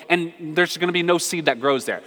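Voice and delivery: ordinary delivery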